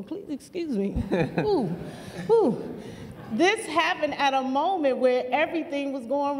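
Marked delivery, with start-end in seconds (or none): 0.0-2.7 real tears rising, breath catching on ooh; overcome with emotion. Real, not acted. Speaking about her community center, choked up with real emotion. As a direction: let the feeling break through, then catch it. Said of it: "gosh, I'm getting emotional".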